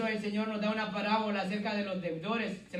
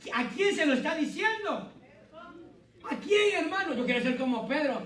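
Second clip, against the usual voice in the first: raised, ringing preacher's voice pressing questions; preaching at full force; 6 semitones higher, 4 dB louder than usual. Acted. Pastor to his congregation, raised preaching voice. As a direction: preach it out to the whole church.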